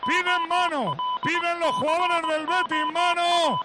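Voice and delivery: roaring goal call, high and loud; breakneck goal commentary. Real, not acted. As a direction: shout the goal over and over. Goal call on live radio.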